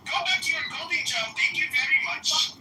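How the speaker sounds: hammed-up Indian English caricature, needling the other guy; put-on Indian accent. Trolling a stranger online in a put-on Indian accent.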